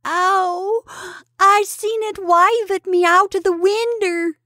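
high, drawn-out frightened wail; slow, high-pitched childish howl; wailing boy. A narrator's reading. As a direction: wail slowly and loudly like a scared village lad. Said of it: "wailed the boy".

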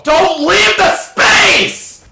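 very high, loud, slow desperate yell; frantic shout. Real, not acted. Gamer yelling at a teammate leaving the point, frantic shout. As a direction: scream the order as they leave.

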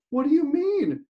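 afraid. Acted. Dangling man asking the rescuer, high frightened question. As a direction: ask in sudden fear.

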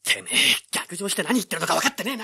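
loud, high angry snap; furious scolding. Acted. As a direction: tear into her for blabbing. Brother berates sister.

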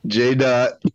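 high, loud sing-song name; teasing call. Real, not acted. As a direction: call his name out playfully.

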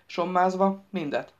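ordinary delivery, nothing marked

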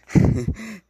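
sudden high burst of laughter. Real, not acted. Laugh after near miss. (risada aguda)

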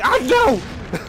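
high, loud jolt of dismay; alarmed cry. Real, not acted. Gamer as the deaths start happening, alarmed. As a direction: cry out as danger hits.

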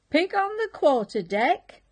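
high, loud alarm call; a hammed-up lookout's shout. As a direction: call it across the deck. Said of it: "called first mate Scratch Whiskers".

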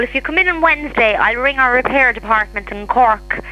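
ordinary talk

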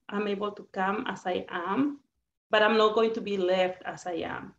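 ordinary talk

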